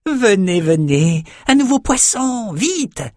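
very high, squeaky frantic call; shaman squealing to raise the alarm. A narrator's reading. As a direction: squeal for the slavers to come. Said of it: "continuait à couiner".

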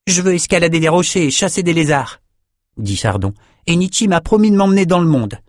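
voix de fillette un peu plus aiguë, hésitante puis décidée; petite fille polie. Acted. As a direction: une enfant qui refuse gentiment. Chardon insiste. (fillette qui décline poliment le cadeau)

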